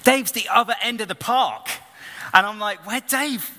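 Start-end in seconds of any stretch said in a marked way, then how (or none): 2.8-3.5 calling after Dave. Retold or imitated. Calling across the park to Dave.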